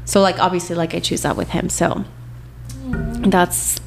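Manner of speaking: ordinary talk, nothing marked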